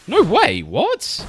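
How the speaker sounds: high, loud burst of disbelief; real astonishment, pitch shooting way up; shocked disbelief. Real, not acted. Streamer reacting to Tears of the Kingdom flopping in Japan, shocked disbelief. Reacting to surprising sales figures.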